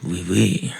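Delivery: put-on warming up a French accent; playful put-on French; practice French accent. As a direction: try out your French with stock phrases. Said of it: "that was a terrible French accent".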